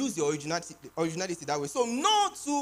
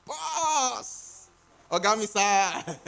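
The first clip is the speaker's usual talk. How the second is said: fawning whine; 4 dB louder, much slower than usual. Retold or imitated. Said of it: "we whine ourselves a lot".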